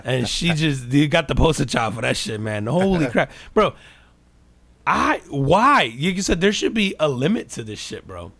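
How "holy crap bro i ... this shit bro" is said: loud high indignant rant. Real, not acted. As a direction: rant in disbelief.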